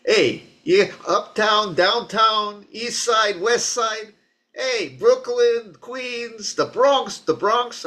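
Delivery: put-on high, old New York accent, place by place; father's old New York accent. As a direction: call out like an old-school New Yorker. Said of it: "That's how my dad used to talk".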